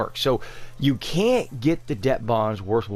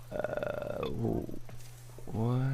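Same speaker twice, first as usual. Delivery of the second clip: breathy dismay; 2 semitones lower, 10 dB quieter, much slower than usual. Real, not acted. Another shocking price appears.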